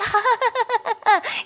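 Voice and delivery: high bubbling giggle; a gleeful little laugh. Real, not acted.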